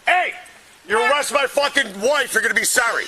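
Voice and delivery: loud, high-pitched angry warning; angry threat. Real, not acted. Threatening police over wife's arrest.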